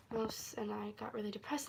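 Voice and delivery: quick, whispered confession; whispering. Real, not acted. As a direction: hush it out quickly. Sad memory, dropped voice.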